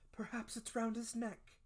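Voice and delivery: high-pitched woman's voice; mother suggesting. A narrator's reading. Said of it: "suggested my mother".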